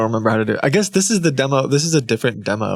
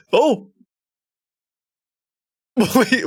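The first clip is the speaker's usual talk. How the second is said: laughing; 10 semitones higher, 3 dB louder than usual. Real, not acted. Laughing surprise.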